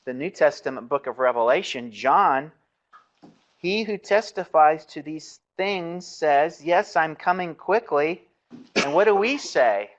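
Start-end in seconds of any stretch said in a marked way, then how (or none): 3.6-8.3 slow, raised declaiming voice; scripture recitation. Acted. Teacher quotes Revelation's closing words to the class, solemn recitation. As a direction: recite it slowly with weight, pitched up.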